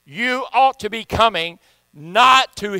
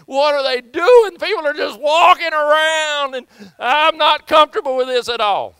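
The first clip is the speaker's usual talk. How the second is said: uneasy visitor; 8 semitones higher, 7 dB louder than usual. Retold or imitated. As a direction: play a churchgoer thrown by the altar calls. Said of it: "and they go".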